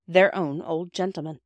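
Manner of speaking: ordinary delivery, nothing marked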